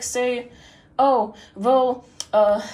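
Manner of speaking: usual speaking voice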